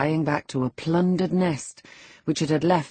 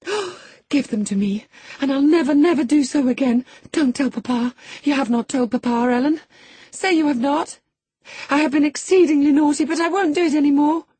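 put-on much higher and louder, fast at the end, a frantic girl; frantic pleading; 12 semitones higher, 6 dB louder than usual. A narrator's reading. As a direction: beg desperately not to be told on. Girl begging on her knees.

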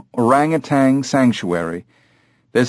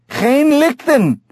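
very high, stretched-out shout; straining high, slow holler; louder repeated call; slower than usual. A narrator's reading. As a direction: call insistently after the climbing ape. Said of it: "The heavy-set man said loudly".

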